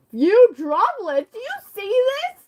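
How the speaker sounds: high, loud, slow incredulous Few; mock disbelief. Real, not acted. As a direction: stretch out the disbelief. Mocking disbelief at rain.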